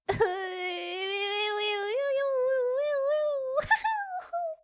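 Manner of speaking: drawn-out wobbling squeal of joy; high, sustained happy wail; long high gleeful squeal. Real, not acted. Overjoyed streamer celebrating a gift, high squeal.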